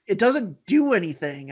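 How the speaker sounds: usual speaking voice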